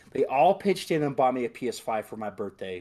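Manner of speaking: usual speaking voice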